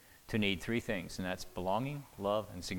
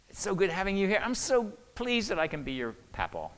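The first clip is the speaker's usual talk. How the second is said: tender to grandkids; 9 semitones higher, 4 dB louder than usual. Retold or imitated. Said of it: "I just let them know".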